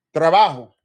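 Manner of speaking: loud punchy trabajo; spanish answer said loud and clear; demo answer. Shown as a demonstration. Teacher modeling his answer to a student, loud. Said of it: "My response is going to be".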